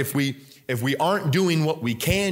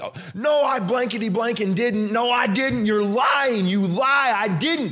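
high, raised-voice protest of innocence; indignant denial; 8 semitones higher than usual. Acted. Judged man protesting to God that he is innocent, indignant denial.

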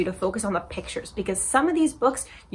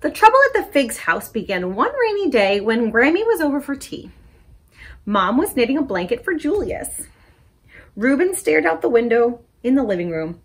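put-on lively picture-book read-aloud for kids; story time reading; 3 semitones higher, 6 dB louder than usual. A narrator's reading. Librarian reading a story to children, animated. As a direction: read it bright and animated to little listeners.

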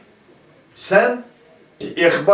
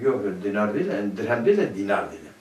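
mumbled self-correction; 9 semitones lower, 8 dB quieter, faster than usual. Retold or imitated. Teacher acts out a self-correction.

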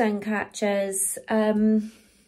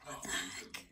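low stunned whisper; 16 dB quieter than usual. Real, not acted. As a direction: breathe it out in disbelief. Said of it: "I have to whisper".

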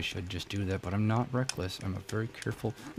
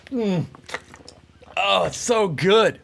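loud, high, drawn-out gushing; mock rapture; 9 semitones higher, 9 dB louder, slower than usual. Gamer raving about a drink of water, mock rapture. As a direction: gush over it as an obvious put-on.

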